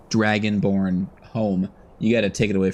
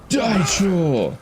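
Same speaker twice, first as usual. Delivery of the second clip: battle cry; 8 semitones higher, much slower than usual. Real, not acted. Attacking in fight.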